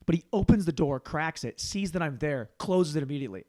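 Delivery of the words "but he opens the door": ordinary delivery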